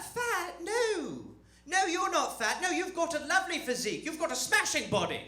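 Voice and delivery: high-pitched British mum, gushing denial; reassuring British mother. Retold or imitated. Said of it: "this little British liar".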